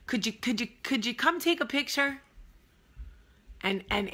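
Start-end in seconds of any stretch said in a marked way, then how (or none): 0.1-2.2 put-on high-pitched, wheedling Northern accent. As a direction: imitate a Northerner asking a favor. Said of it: "I like to do a northern accent".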